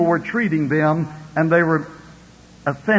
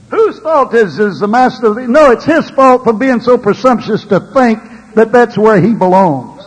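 high, emphatic preacher's rebuke; forceful preaching; 5 semitones higher, 7 dB louder than usual. Real, not acted.

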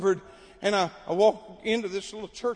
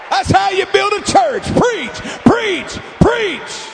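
loud, high, driving revival preaching; full-force preaching; 6 semitones higher, 9 dB louder than usual. Real, not acted. Preacher urging home missionaries to keep preaching, full-force shout. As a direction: cry it out as a rousing exhortation.

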